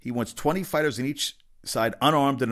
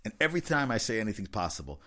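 normal talking voice

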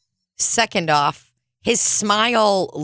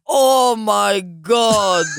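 a drawn-out, louder "Oh, my God" at a stench; slow disgusted exclamation; 2 semitones higher, 4 dB louder, much slower than usual. Retold or imitated. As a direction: stretch "Oh, my God" out, louder, appalled by a smell. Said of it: "it would probably just be like".

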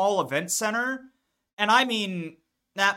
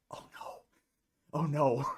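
panicked whisper; 4 semitones lower, 9 dB quieter than usual. Retold or imitated. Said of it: "and I'm just like".

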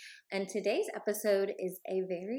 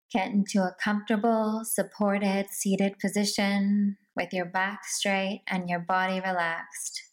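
slow, evenly paced meditation guide; guided meditation; slower than usual. Acted. Host guiding listeners into a seated meditation, slow meditation voice. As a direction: lead them gradually into stillness, unhurried.

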